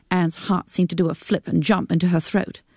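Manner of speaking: plain reading voice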